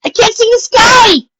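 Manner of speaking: high, loud, slow panicked yell. A narrator's reading. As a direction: scream in terror at the snow. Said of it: "she finally yelled".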